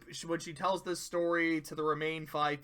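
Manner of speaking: ordinary talk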